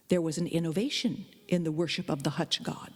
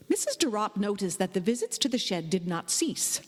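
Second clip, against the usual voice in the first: plain reading voice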